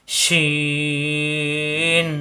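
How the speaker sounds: শিন with its ee held very long on one level note; long-drawn letter name. Shown as a demonstration. Teacher demonstrating the letter's length, long-held শিন. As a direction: stretch the vowel of শিন steadily before closing on n. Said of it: "চারালি পরিমাণ টান হবে".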